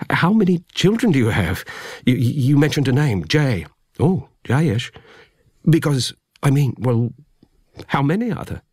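high, urgent, tumbling questions; agitated. A narrator's reading. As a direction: press him, barely holding it together. Narrator agitated, leaps to feet.

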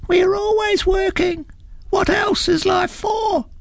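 very high, small busy ant voices; tiny ant voices. A narrator's reading. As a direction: squeak it up as a marching ant. Tiny ants answer the troll.

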